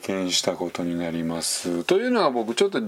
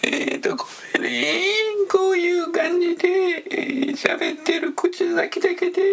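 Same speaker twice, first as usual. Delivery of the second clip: very high voice from the lips only; tight high voice. Shown as a demonstration.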